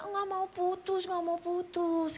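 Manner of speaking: high, drawn-out whiny refusal; hammed-up pleading, words dragged out high; clinging mistress. Mistress refusing to break up with her lover, clinging plea.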